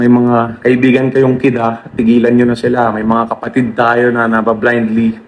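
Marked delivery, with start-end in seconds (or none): none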